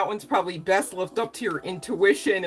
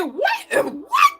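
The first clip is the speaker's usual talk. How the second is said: high, louder shocked "What?" cracking into a cry; shocked shriek; 5 dB louder, slower than usual. Retold or imitated. Reacting to a viewer's wish with a shocked shriek. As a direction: shriek "What?" in mock disbelief. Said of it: "Now I said".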